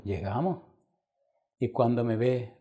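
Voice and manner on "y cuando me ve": usual speaking voice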